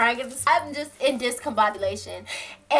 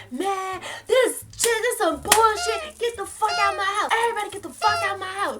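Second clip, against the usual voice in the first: high-pitched mock outrage, speeding up at the end; angry boyfriend impression; 9 semitones higher than usual. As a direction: imitate a fed-up man throwing everyone out.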